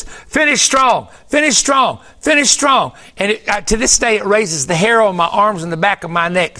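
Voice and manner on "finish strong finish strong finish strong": high, ringing repeated proclamation; chorus of voices. Retold or imitated. Preacher retelling the message they heard five times, chorus-like. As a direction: chant it slowly in a raised pitch, each time alike. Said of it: "it was like a chorus of voices".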